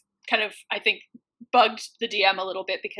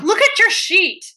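much higher and louder, slow and pointed; mock exasperated order; 11 semitones higher, 6 dB louder than usual. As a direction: spell it out to a player who won't look.

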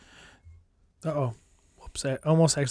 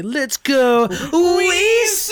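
long, high hollered chant; stadium cheer; 12 semitones higher, 6 dB louder, much slower than usual. Retold or imitated. Fan cheering on the team, drawn-out holler. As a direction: stretch it out as a loud fan cheer. Demonstrating their ballpark chant.

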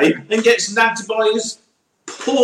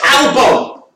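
higher, sudden call of the name; excited call-out; 8 semitones higher than usual. Real, not acted. Interrupting a co-host with the driver he remembered, excited call-out.

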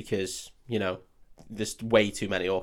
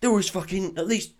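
high, put-on protesting hobbit; hammed-up high-pitched protest; Sam; 8 semitones higher than usual. Loyal hobbit protesting to his master about missing bread, mock distress. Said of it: "And then Sam's just like".

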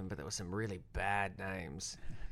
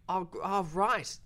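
high, louder, uneasy stammer; nervous agreement; 9 semitones higher, 5 dB louder than usual. Retold or imitated. Crew humours a man threatening murder, high nervous stammer. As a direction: humour him nervously, voice jumping up. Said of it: "We were like".

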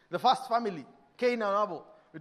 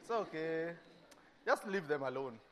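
meek soothing; 4 semitones lower, 7 dB quieter than usual. Retold or imitated. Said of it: "you're like".

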